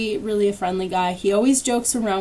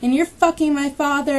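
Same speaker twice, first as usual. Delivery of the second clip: higher, imitated angry accusation; mock rant in a raised pitch; retold screaming; 6 semitones higher than usual. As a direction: hurl blame as the furious woman. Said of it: "she just kept screaming at me".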